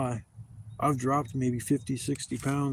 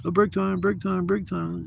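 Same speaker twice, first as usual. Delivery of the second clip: mocking whine; 6 semitones higher than usual. Mocking a worker taking breaks.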